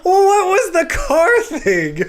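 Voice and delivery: high, loud, slow through laughter; helpless real laughter in the voice; laughing. Real, not acted. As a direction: ask it while cracking up. Cracking up at the impression.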